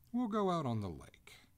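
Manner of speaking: spring it as a sudden decision; sudden resolve. Acted. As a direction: start up with a decisive idea. Master starting up, announces plan.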